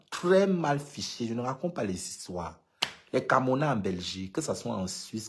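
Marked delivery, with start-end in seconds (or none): 0.1-1.3 "très mal fiché" pitched higher and slowed; emphatic insistence. Real, not acted. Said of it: "je te dis là".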